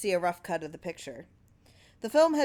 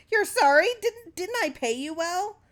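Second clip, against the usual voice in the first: very high, loud berating; furious scolding; 12 semitones higher, 7 dB louder than usual. Acted. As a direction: lash out at the ungrateful worker. Clarence berating Terwilliger.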